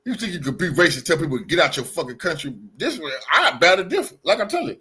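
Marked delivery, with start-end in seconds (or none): none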